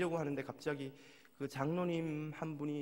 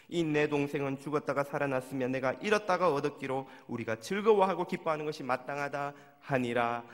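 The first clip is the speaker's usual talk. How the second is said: scripture reading; 6 dB louder than usual. Acted. Reading the father's words aloud.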